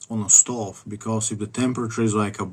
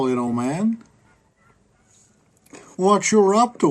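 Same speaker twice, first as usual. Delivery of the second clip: higher, louder, sly fake-friendly agent voice; mock FBI agent sweet-talking a suspect; 6 semitones higher, 4 dB louder than usual. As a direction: play a creepy overfriendly federal agent knocking. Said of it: "the F B I would be like".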